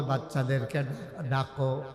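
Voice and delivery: low, soft request; quiet instruction. Acted. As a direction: softly ask for the children. Omar gently tells wife to call. (নিচু গলায় নরম নির্দেশ)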